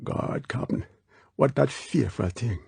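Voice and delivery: hushed, awed island dialect; whispered. A narrator's reading. Quarrel seeing the creature on the lake, whispering. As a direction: whisper it in alarm. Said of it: "Quarrel whispered".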